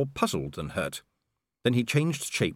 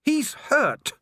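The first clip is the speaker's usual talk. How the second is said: much higher, cracked with grief; distraught anger; 4 dB louder than usual. A narrator's reading. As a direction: shove the words out in despair. Angua grieving, angry.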